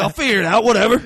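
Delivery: loud, high, cocky mock improvising; brash ad-libbing. Imitating the actor who just showed up on set, brash and loud. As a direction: blurt it out like a loose cannon.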